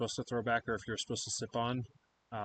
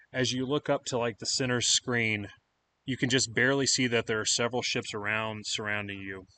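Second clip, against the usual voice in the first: ordinary talk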